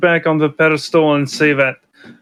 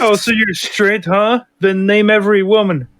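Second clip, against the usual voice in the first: high-pitched, taunting put-on challenge; mock challenge; 6 semitones higher than usual. Parodying the guy challenging a girl, high mocking taunt.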